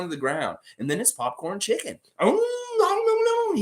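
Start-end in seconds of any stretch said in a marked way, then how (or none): 2.2-3.6 very high, cartoonish "oh no, no, no"; squeaky hawk panic. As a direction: squeal it like a doomed little bird. Hawk getting clubbed and eaten.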